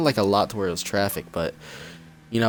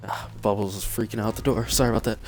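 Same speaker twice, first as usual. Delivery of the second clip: usual speaking voice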